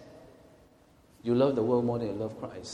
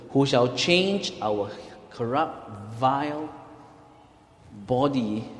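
very slow scripture reading with long pauses; 2 semitones lower, slower than usual. Real, not acted. Reading Philippians to the congregation, word by word, slowly. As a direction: let each word of the verse stand alone. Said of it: "let me just read slowly".

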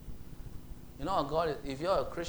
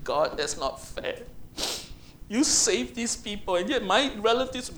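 whiny complaint; 4 semitones higher than usual. Acted. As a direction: sulk like a wronged child. Jonah complaining to God.

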